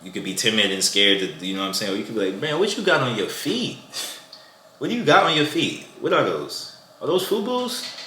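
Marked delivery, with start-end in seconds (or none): 2.4-7.9 playful teasing. Shown as a demonstration. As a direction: rib her about the shoes, hamming it up. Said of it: "you playful".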